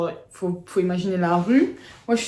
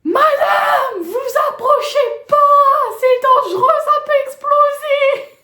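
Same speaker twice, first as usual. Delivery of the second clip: high, loud panicked yell; mock a stranger screaming a warning from afar; imitated warning shout; 7 dB louder, slower than usual. Passer-by warning the woman off the bin, high mock shout. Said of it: "Et il crie".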